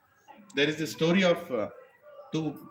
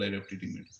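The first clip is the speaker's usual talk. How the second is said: softly quoted boast; mock quiet quote; 8 semitones lower, 8 dB quieter than usual. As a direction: quote the boast under the breath. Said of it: "Why are you telling so many people".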